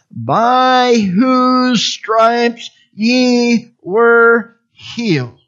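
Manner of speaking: very slow, drawn-out words; emphatic scripture reading. Real, not acted. Preacher reading scripture to the congregation, slow and emphatic. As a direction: stretch each word out for weight.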